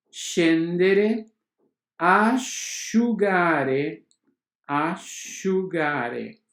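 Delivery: uscita, scendere and asciugare, each with a "sh" sound, said slowly. Shown as a demonstration. Teacher to students drilling sc words with sh. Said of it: "o S C seguido das vogais E e I".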